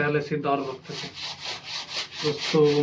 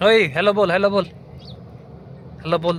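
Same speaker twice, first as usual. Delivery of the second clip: calling out to the dog; 3 semitones higher than usual. Real, not acted. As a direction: get the dog's attention, urging it to say hello.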